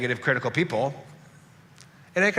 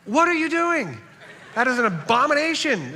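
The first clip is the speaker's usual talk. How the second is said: much higher, loud mock horror; mock outrage; 11 semitones higher, 3 dB louder than usual. Real, not acted. Pastor mock-scolding Texas cooks, high-pitched comic outrage. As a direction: cry out as if scandalized by the taco. Outraged at ground beef tacos.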